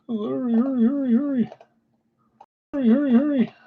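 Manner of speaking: high put-on voice, urging; high-pitched urging. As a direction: chant hurry in a high voice. Urging a slow website on.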